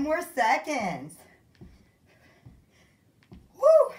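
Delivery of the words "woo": high, loud "woo" whoop; whooping cheer. Real, not acted. Instructor rallying the workout class, loud high whoop. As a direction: let out a big energizing whoop.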